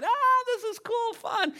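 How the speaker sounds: high-pitched, loud cheering; crowd yelling. Acted. Crowd watching the gorilla's antics, high excited yelling. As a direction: yell it like a delighted spectator. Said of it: "people started yelling".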